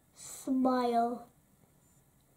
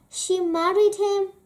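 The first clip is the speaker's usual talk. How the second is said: plain reading voice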